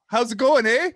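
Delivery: loud, chirpy Canadian greeting with a big eh; hammed-up Canadian accent. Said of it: "he can speak in that Aussie drawl ... And I, I just".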